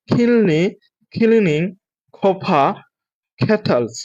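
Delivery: word by word with pauses; slow practice. Shown as a demonstration. Teacher models the tongue twister for students, slowly. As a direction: say each word separately and slowly. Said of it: "আস্তে আস্তে করে প্রথমে চেষ্টা করবেন".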